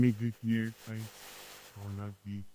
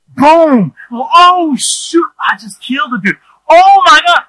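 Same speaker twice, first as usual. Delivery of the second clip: much higher and louder, panicked yelling; panicked shout; 13 semitones higher, 12 dB louder than usual. Retold or imitated. Reliving the fallen man, high panicked shouting. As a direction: yell like you just flattened him.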